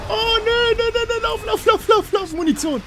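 very high frantic repeats; panicked squealing. Real, not acted. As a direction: squeal it out while fleeing in a game. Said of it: "Hat man an deinen männlichen Schreien gehört". (panisches, hohes Quieken)

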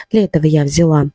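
ordinary talk

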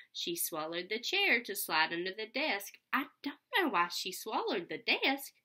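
lively read-aloud storytelling voice; sing-song picture book reading; reading to children. Acted. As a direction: read it out with bounce for little kids.

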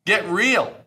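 loud, high, heated rant. Real, not acted. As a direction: rail against naive pitchers. Ranting at naive pitchers.

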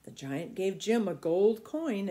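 usual speaking voice

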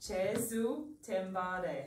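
Jesu, pause, te mare, carefully copied; slow repeat. Shown as a demonstration. Teacher copying the new Burmese phrase, slow repeat. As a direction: sound it out in two slow parts. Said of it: "Can you say it slower?".

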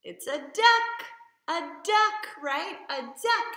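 put-on bright, excited voice for little kids; reading to a toddler. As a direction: cheer the kids on with the answer.

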